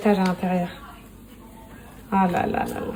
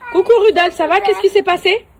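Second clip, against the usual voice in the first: calling out; 8 semitones higher, 6 dB louder than usual. Real, not acted. Calls out to the pool man.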